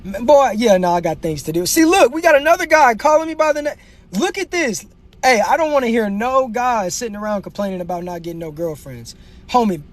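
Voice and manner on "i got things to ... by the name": ordinary talk, nothing marked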